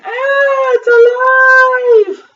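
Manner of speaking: very high, loud drawn-out shriek; scream. A narrator's reading. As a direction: scream it long and high in fright. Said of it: "The lady screamed".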